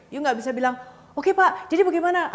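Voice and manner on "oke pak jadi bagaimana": "bagaimana" pitched high and loud, over-eager; rising intonation. Shown as a demonstration. Salesman wrongly closing a deal with a customer, rising intonation. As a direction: lift "Oke, Pak, jadi bagaimana" up high and louder. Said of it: "nggak bisa pakai intonasi naik".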